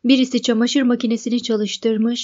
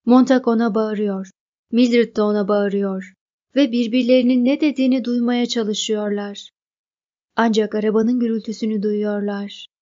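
usual speaking voice

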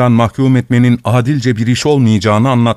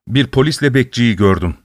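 usual speaking voice

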